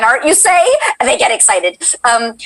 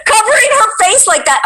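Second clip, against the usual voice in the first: imitating an eager fan, rising high; excited chat comment; 6 semitones higher than usual. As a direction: read out a fan's demand, pitched up. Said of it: "is very excited".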